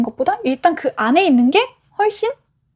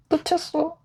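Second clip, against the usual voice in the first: soft, higher, stunned admission; retold sinking dismay; 4 semitones higher, 7 dB quieter than usual. Imitating housemate who missed the roach, hushed dismay. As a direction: imitate someone quietly realizing disaster. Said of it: "그 놓쳤다는 말을 할 때".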